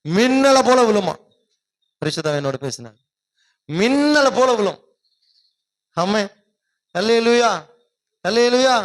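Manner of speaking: loud, high hallelujah preaching; fiery preaching. Acted. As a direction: lift the congregation with a ringing call.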